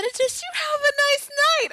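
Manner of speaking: high, loud mock mob boss threat; menacing mock gangster line; a hammed-up mob boss warning. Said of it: "he was very mob boss when he said".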